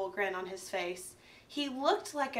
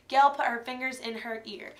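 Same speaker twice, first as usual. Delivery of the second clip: ordinary delivery, nothing marked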